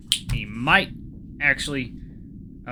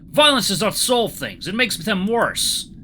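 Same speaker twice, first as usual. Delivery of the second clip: put-on slow, raised, forceful Russian accent; 8 semitones higher, 5 dB louder, slower than usual. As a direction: rebuke firmly in a Russian accent. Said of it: "see if I can do a Russian accent".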